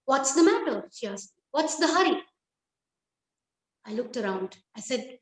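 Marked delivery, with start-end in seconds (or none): 0.0-2.2 higher, alarmed questions; a startled mother's quick questions. A narrator's reading. Startled mother asks her son, alarmed and higher. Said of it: "My mother, she was startled".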